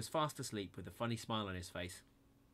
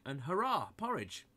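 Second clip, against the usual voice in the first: higher, louder cheer; louder, higher hurrah; delighted; 6 semitones higher, 5 dB louder than usual. A narrator's reading. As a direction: cheer at finding food. Finding porridge at last.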